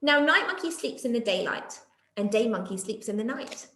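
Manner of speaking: plain reading voice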